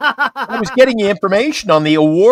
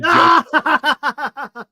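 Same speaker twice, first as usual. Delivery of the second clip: laughing; 9 semitones higher than usual. Real, not acted. Laughing, can't stop.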